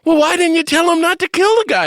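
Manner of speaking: very high, loud exasperated yell; retold yelling. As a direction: yell it in comic disbelief. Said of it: "I started yelling at Mother Teresa".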